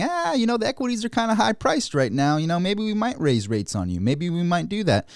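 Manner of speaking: high, teasing Fed voice warning of rate hikes; mock Fed official. To stock investors, the Fed teasing about raising rates, mock high coy voice. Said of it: "You don't want the Fed saying".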